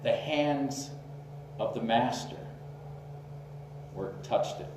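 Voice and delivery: hushed answer with long, weighty pauses; quiet, reverent reply. Retold or imitated. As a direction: answer softly, leaving space between the phrases. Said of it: "the auctioneer said very quietly".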